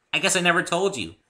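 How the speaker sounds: high, loud excited pony voice; bubbly Pinkie explaining; eager high chatter. A narrator's reading. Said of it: "said Pinkie".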